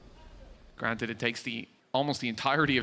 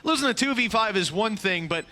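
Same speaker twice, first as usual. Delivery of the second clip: louder, higher hype call; esports caster; 6 semitones higher, 3 dB louder than usual. Acted. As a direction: ride the clutch with rising energy. Live play-by-play casting.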